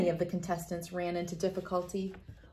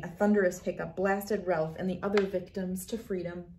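ordinary delivery

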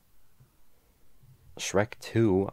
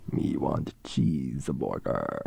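much lower, slow caveman voice; caveman grunt; 9 semitones lower than usual. Pretend. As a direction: say it like a dim caveman. Caveman joke repeated lower.

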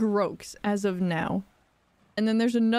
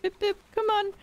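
high, short pips; duckling-calling voice; 10 semitones higher than usual. Pretend. Player calls ducklings to follow, high chirpy voice. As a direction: chirp it high like a little bird.

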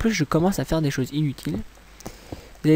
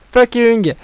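loud, high outburst; high, exasperated curse; frustrated swearing; 8 semitones higher, 7 dB louder than usual. Real, not acted. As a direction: shout the swear word in frustration. Swearing at own building mistake.

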